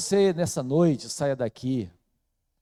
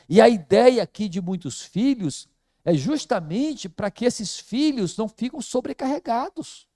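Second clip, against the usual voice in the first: normal talking voice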